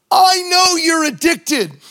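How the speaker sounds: preaching to a drug addict on the highways, emphatic; emphatic appeal. Acted. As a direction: plead it earnestly. Preacher voices street outreach words.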